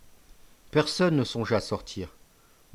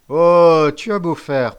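loud, higher threatening cry; menacing warning; 5 semitones higher, 12 dB louder, slower than usual. A narrator's reading. Chief warning the struggling prisoner, loud threat. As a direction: warn the captive loudly.

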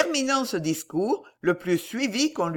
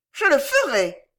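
high, tart lady's vow; reply shrilly and firmly; shrill resolve; 7 semitones higher, 5 dB louder than usual. A narrator's reading. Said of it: "elle dit d'une voix aigrelette". (réponse aigrelette et résolue)

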